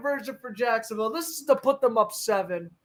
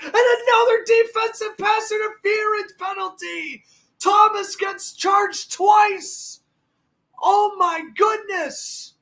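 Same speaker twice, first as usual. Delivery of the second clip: excited shouting; 8 semitones higher than usual. Real, not acted. Penalty play-by-play call.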